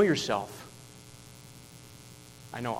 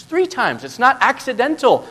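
pitched up high, pressing hard on three and not; emphatic high preaching; 9 semitones higher, 6 dB louder than usual. Real, not acted. Preacher stressing Peter's denial to the congregation, forceful. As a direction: drive it home to the church.

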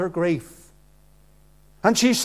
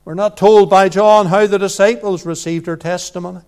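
ordinary talk, nothing marked

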